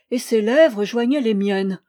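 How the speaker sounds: ordinary delivery, nothing marked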